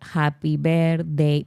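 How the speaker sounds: slow, romantic-ballad happy birthday with a thick Spanish accent; strong Spanish accent. Shown as a demonstration. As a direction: croon it slowly in heavy Spanish-accented English. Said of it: "but with very strong accent".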